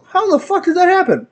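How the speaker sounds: high-pitched disbelief; incredulous outburst. Retold or imitated. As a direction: demand an explanation in high-pitched bafflement. Incredulous question about the crash.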